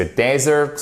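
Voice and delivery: desert with the first syllable stressed, short e. Shown as a demonstration. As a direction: hit the DE of desert, open E. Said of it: "avec l'accentuation sur la première syllabe".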